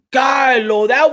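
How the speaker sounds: loud call. Real, not acted. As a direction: call across the room. Calling out to Carlo.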